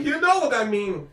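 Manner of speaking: put-on mother's emphatic French Canadian accent. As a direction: brush it off in thick French Canadian English. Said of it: "French Canadian accent".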